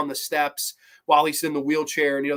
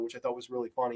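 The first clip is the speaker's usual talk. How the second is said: ordinary delivery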